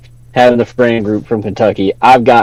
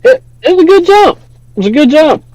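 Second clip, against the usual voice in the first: squeaky falsetto comment; mock high voice. Streamer praising the jump, still in high-voiced mimicry.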